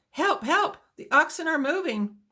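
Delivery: high, loud panicked cries for help; terrified yell for help; frightened scream. A narrator's reading. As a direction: shriek as the oxen bolt. Squealing on bucking wagon.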